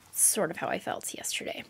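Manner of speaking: soft unvoiced whisper. Real, not acted. Vlogger admitting how crushed she felt, whisper. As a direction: breathe it out like a confession. Describing feeling squeezed to death.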